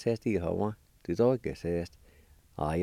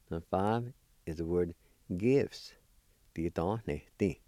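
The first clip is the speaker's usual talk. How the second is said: usual speaking voice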